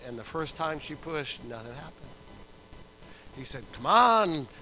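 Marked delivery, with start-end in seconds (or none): 3.7-4.5 loud doctor's exhortation; retold urging. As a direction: imitate a doctor urging her on.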